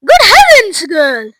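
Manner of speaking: high, loud shocked outburst; sudden shriek. A narrator's reading. Missus Beauregard staring at Violet, shrieking in alarm. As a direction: shriek in horror at the sight. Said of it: "shrieked Missus Beauregard suddenly".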